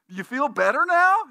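high, loud startled therapist; Billy Crystal. Retold or imitated. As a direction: jump back and squeak it out, rattled. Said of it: "he goes".

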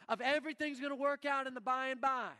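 ordinary talk, nothing marked

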